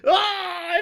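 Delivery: high mock monster cry; transforming Titan scream. Acting out a man turning Titan, screaming high. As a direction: burst out with a high drawn-out yell.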